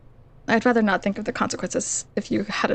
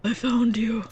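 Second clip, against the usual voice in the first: lightly voiced old man; a hint of a frail old man; slower than usual. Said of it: "did you hear my old man voice?".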